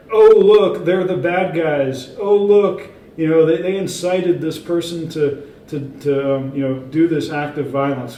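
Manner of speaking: loud, high outcry easing into accusation; mock media alarm. Mainstream media blaming the author, loud mocking alarm. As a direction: sound the alarm as a mocked accuser. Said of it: "mainstream media would be like".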